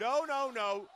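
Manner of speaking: loud string of cries; alarmed protest. Real, not acted. Commentator objecting to an arm jammed in post, alarmed shout. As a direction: call out in disbelief.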